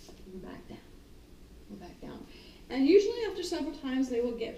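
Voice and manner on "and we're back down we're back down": whispered back-down cue; hushed cue. Retold or imitated. Trainer calmly getting dog down, whispered. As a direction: breathe it to the dog.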